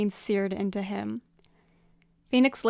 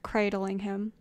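plain reading voice

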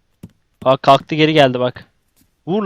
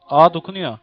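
usual speaking voice

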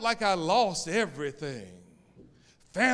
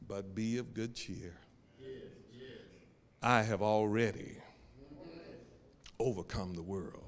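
hushed Jesus quote; 6 semitones lower, 11 dB quieter than usual. Acted. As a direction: draw them in with a near-hush. Preacher voicing Jesus, hushed.

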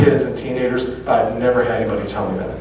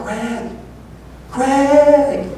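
high-pitched call across a crowd, rising; imitating a girl calling his name from the side; 13 semitones higher than usual. Girl in the crowd calls out to Greg, high and rising.

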